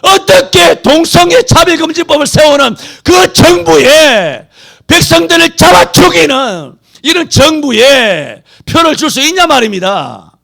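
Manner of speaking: loud, high, slowing preacher's cry; impassioned sermon shout; fiery preaching. Acted. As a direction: thunder it from the pulpit. Preacher rails against vote.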